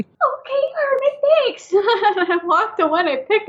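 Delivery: squeaky high, giggling mock thanks; high giggly. Retelling her thanks to a prankish ghost, high and giggly.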